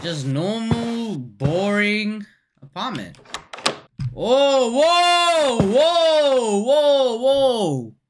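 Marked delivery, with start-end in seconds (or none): 4.1-7.9 startled. Real, not acted. Startled by something scary.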